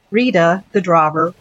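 plain reading voice

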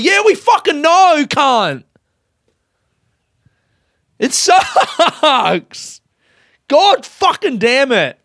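high, loud furious yell at the doubters; angry outburst. Real, not acted. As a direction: spit it out, fed up and furious. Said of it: "clearly I'm angry".

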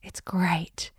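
breathy whisper; soft unvoiced "It's great"; whispered aside. Shown as a demonstration. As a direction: whisper it like a secret. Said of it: "now I just go".

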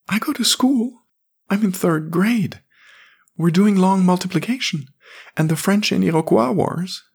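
high, eager schoolboy voice; proud little boy. A narrator's reading. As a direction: show off like a third grader. Jamie boasts.

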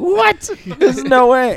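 high-pitched shocked "what?"; disbelief. Retold or imitated. Retelling own disbelief at friends.

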